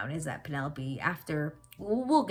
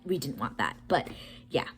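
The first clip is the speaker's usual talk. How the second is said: louder, more excited recorded-later recap; upbeat voiceover catching viewers up; 8 dB louder than usual. Real, not acted. Vlogger recording a voiceover insert, a louder excited recap.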